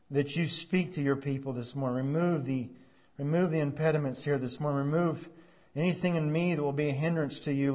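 soft, low prayer voice; hushed pastoral prayer. Acted. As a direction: pray quietly to God. Opening prayer before sermon.

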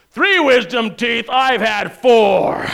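mock a show-off topping your story; one-upping boaster. Retelling a comedian's bit about someone topping your wisdom-teeth story, slow loud brag. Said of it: "comedians ... talks about the you, me mentality".

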